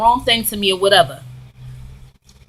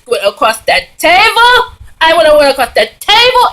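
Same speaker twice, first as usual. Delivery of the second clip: worked-up quote; 7 semitones higher, 6 dB louder than usual. Retold or imitated. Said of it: "She said".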